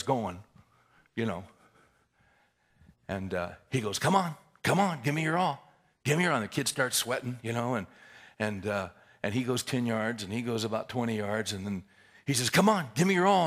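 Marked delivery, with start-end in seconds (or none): 4.0-6.4 raised, higher-pitched coach's urging. Retold or imitated. Coach to struggling kid during the crab drill, urging. 12.5-13.5 louder, high-pitched coach's call; urging coach. Retold or imitated. Coach to kid at twenty yards, urging loudly.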